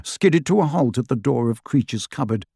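plain reading voice

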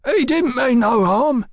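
high, drawn-out snivel; cowed crook pleading for his mate; snivelling. A narrator's reading. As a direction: snivel it slowly, pitched well up. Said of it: "Tally snivelled".

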